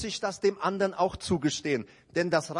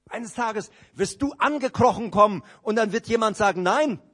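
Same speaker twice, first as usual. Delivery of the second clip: louder, rising warning; preacher's loud warning; 4 semitones higher, 7 dB louder than usual. Real, not acted. Prediger warnt die Gemeinde laut. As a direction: warn the congregation with force. Preacher warns congregation loudly.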